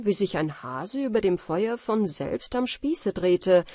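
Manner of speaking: ordinary delivery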